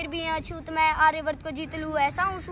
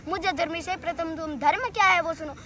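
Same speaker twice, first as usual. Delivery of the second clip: ordinary talk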